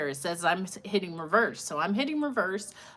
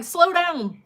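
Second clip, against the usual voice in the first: higher, louder imitated shout; mock yell; 9 semitones higher, 6 dB louder than usual. The niece retelling the man's warning, a higher mock yell. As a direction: act out the angry man yelling. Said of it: "he was like".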